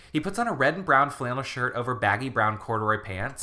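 ordinary talk, nothing marked

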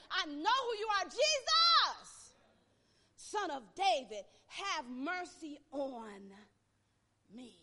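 loud, high plea that slows at the end; pleading cry. Retold or imitated. Blind beggar pleading for mercy, loud rising cry. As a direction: beg him across the road.

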